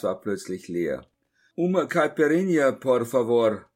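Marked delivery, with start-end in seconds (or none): 1.5-3.7 loud Portuguese call across the room; calling to the bar. Acted. Narrator calls his drink order to the barman, loud. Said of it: "rief ich hinüber zur Schank".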